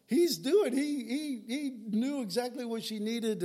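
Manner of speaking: high, loud, amazed delight; excited wonder. Pretend. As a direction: gush like you just saw a miracle. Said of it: "I'd be".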